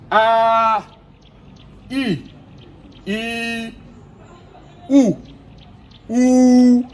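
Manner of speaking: "A, I, OU" each held long, doubled; long drawn vowels, clearly held. Shown as a demonstration. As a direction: stretch every vowel to double length. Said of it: "on va noter la voyelle longue en doublant la voyelle".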